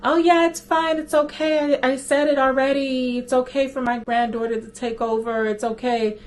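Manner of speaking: high, quavering put-on grandma voice; a young girl pretending to be grandma; fake grandma voice. Girl faking grandma to the bank agent. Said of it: "the girl was playing the grandma role".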